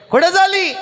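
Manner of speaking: high shout of where are you going; shouted question. Retold or imitated. Man shouts at the old granny on the road. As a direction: call out sharply to her.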